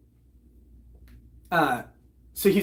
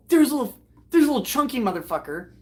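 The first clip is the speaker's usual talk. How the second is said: ordinary talk, nothing marked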